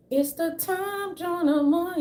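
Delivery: high, slow mock radio announcer. Speaker mocking clueless listeners imitating radio, high and slow. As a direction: drag it out like an old radio host. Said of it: "they'd be like".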